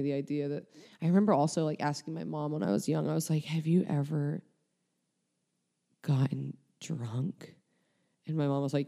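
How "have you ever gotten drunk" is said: hushed question. Retold or imitated. Child whispering to mom about getting drunk. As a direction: whisper the word drunk, slowly.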